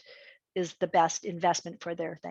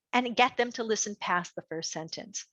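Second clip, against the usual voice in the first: ordinary delivery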